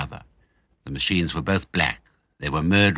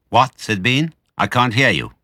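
loud deaf man; 5 semitones higher, 5 dB louder than usual. A narrator's reading. Deaf Bean asks.

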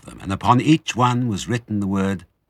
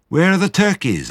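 put-on high, loud child's question; high-voiced puzzled youngster; smallest fox; 11 semitones higher, 5 dB louder than usual. A narrator's reading. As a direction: pipe up like an eager little cub. Smallest fox asks, child figure.

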